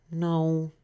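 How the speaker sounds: high, timid little turtle voice; small turtle Franklin. A narrator's reading. Franklin asks the duck for help, high and timid. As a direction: ask like a shy child. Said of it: "said Franklin".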